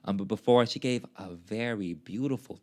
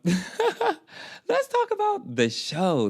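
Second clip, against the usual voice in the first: drawn out, very high laughing through the words; giggling segue back to the concert; 4 dB louder, much slower than usual. Real, not acted. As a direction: laugh it off and change topic.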